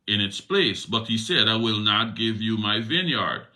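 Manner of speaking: scripture reading. A narrator's reading. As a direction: read Ahab's complaint out with weight. Reads Ahab's reply.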